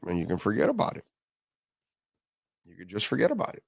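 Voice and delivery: ordinary talk, nothing marked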